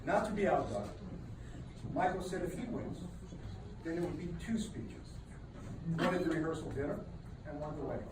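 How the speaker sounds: ordinary talk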